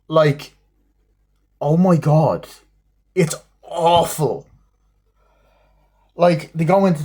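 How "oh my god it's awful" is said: ordinary talk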